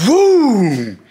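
loud high woo; excited whoop. Retold or imitated. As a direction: whoop with the impact. Slammed into turnbuckle.

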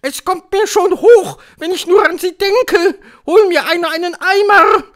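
very loud, high screech; witch crying out. A narrator's reading. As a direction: wail that you are about to be sick. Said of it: "rief die Hoch- und Großmeisterhexe". (Hexe ruft angeekelt, schrill und laut)

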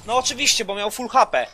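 normal talking voice

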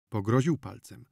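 plain reading voice

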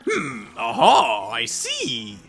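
slow musing that jumps to a loud aha; scholarly discovery, high peak then settling; professor's eureka. Acted. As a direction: draw out a theatrical realization. Professor has a realization.

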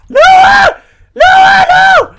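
high desperate screams; screaming. Real, not acted. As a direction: scream no in panic. Dying in game, screaming.

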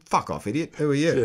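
a mild mock brush-off, rising on who are you; dismissive official. Retelling how officials dismissed his railway request. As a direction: imitate a curt official telling him off. They went.

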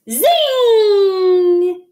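long, loud, high "zing"; zinging sound effect. A narrator's reading. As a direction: let the zing ring out and glide. Story sound of flying off.

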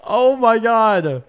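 very high, loud shrieks with laughter; ecstatic screaming. Real, not acted. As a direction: scream with joy at a huge win. Huge slot win reaction.

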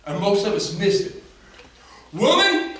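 high, loud preaching shout; full-force preaching. Acted. Preacher shouting to the congregation at full force. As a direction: preach it out to the crowd.

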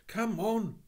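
high, loud schoolboy urging; excited child's summons; urgent boy. Acted. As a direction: call out to hurry someone along. Boy urges policeman to hurry.

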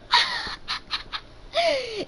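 big burst of high laughter; loud laugh. Real, not acted. Kid bursting out laughing at Siri's answer. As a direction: cracks up at the phone voice.

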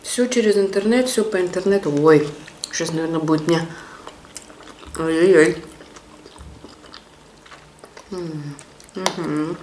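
words muffled by chewing; talking while chewing. Real, not acted. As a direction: mouth full of food. Seen 5: Chewing sausage mouthfuls throughout, forkfuls bitten at 2.0 seconds.

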